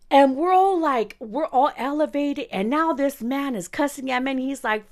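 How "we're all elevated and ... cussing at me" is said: ordinary talk, nothing marked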